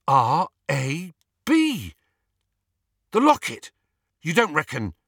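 high, loud dawning excitement; excited realisation. A narrator's reading. Ron working out who owned the locket, excited realisation.

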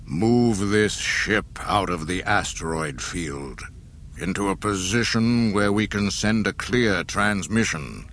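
cold, slow command through a mask; Darth Vader voice. Acted. Vader gives orders.

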